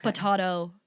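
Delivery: potato with an AH, puh-TAH-toh; long AH in the middle of potato; British pronunciation. Shown as a demonstration. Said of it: "it is the American British".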